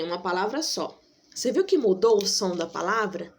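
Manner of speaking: ordinary talk, nothing marked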